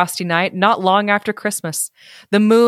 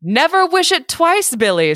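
a touch higher, sly little man; lightly voiced leprechaun; 9 semitones higher than usual. Leprechaun offering the drunk a drink, lightly voiced. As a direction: tease him like a sly fairy.

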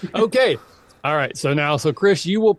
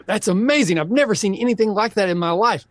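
higher-pitched hammed-up amazement; gushing onlooker; 6 semitones higher than usual. Onlooker praising a dancer in a mock dance-off, gushing. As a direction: gush like an impressed fan.

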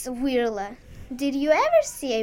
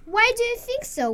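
yelled; 10 semitones higher than usual. Acted. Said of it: "Wirla yelled".

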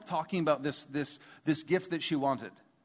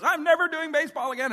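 high, louder mock-kid outburst; upset little kid; 11 semitones higher, 5 dB louder, faster than usual. Kid hit by the ball, mock upset. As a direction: imitate a small child quitting in a huff.

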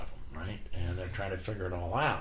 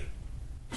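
quiet breathy laugh; 10 dB quieter than usual. Real, not acted. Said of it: "I started laughing".

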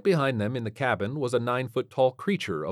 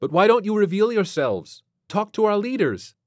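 higher, louder, pressing questions; earnest lieutenant; 12 semitones higher, 7 dB louder than usual. Acted. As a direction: press the alien for an answer.

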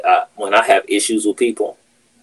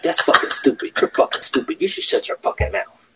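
mock cussing in a raspy, half-breathed voice; imitating coworker cussing; 9 semitones higher than usual. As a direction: mimic the coworker's insults, higher and breathy. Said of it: "I got".

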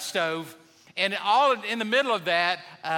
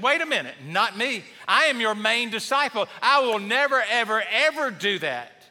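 rising, louder insistent protest; indignant protest; 4 semitones higher, 4 dB louder than usual. Retold or imitated. Peter insisting to Jesus, loud indignant protest. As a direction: Peter swears he'd never deny Jesus.

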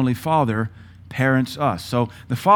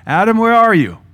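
much higher, louder call for Adam; God calling out; 10 semitones higher, 9 dB louder than usual. Retold or imitated. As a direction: call across the garden to find him.

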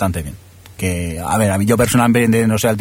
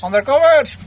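burlón. Real, not acted. Burla del título de una serie.

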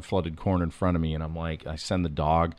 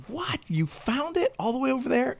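much higher, incredulous friend's voice; amazed friend. Retold or imitated. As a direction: squeak out his disbelief. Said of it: "the guy's like".

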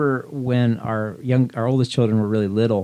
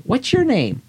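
put-on high playful quiz to a little child; sing-song quiz question; 14 semitones higher, slower than usual. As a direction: ask it high and teasing. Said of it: "And I would say".

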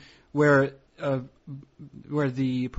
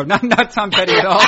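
real laughter breaking through the words, louder and higher; laughing; 6 semitones higher, 7 dB louder than usual. Real, not acted. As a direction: laugh it out while you deny it. Joke cracks both hosts up.

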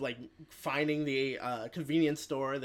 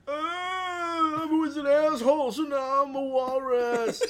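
mock walrus lament; 11 semitones higher, 6 dB louder, slower than usual. Walrus-man's pitiful lament.

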